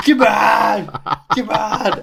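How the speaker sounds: high, loud mock shout; frantic shouting. Imitating Mulder beating out a fire, frantic shouting. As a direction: shout it high like a man in a frenzy.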